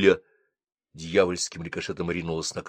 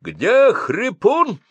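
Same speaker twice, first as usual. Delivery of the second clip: much higher, loud and slow yelling; 6 dB louder, much slower than usual. Acted. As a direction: bellow the question over the crowd. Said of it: "орал старшина".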